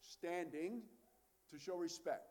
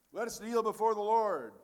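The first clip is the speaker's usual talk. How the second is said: raised, ringing call to the congregation; liturgical call to kneel; 5 semitones higher, 12 dB louder than usual. Shown as a demonstration. Minister calling the congregation to kneel, raised and ringing. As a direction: proclaim it from the front to the pews. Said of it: "we say".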